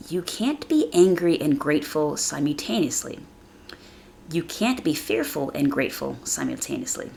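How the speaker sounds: usual speaking voice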